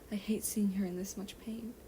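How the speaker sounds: ordinary delivery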